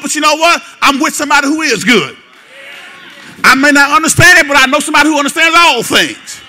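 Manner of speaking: high, loud, crying-out preaching; full-force preaching. Acted. Preacher to congregation, shouting faith at full force. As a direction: lift it to a shouting testimony of trust.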